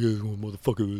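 low, soft tough-guy growl; imitating a tough friend, low and soft. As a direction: do Glenn as a real tough guy. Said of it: "he sounded like this".

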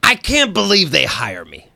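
lightly voiced, a hint of Harvey Fierstein; a light touch of the Fierstein voice; Harvey Fierstein impression. Host teasing cohost with a mild Harvey Fierstein voice. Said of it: "The way you do it".

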